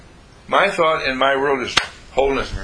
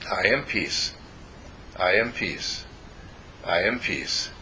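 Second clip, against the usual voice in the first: soft, slow-falling mantra, lower and softer; calm self-affirmation; 4 semitones lower, 7 dB quieter than usual. Shown as a demonstration. Telling yourself you are at peace, soft mantra. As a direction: murmur it to yourself as if settling into peace. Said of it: "you say to yourself".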